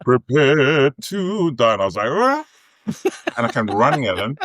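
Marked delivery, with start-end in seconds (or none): 0.0-1.7 slow, drawn-out, higher battle cry; full haka-like challenge. Retold or imitated. Actor on stage challenging his opponent to the fight, full haka-like. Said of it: "like, full Maori haka almost". 2.1-2.5 imitated high battle yell; haka-like war cry. Actor charging at his opponent on stage, haka-like war cry. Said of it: "like, full Maori haka almost".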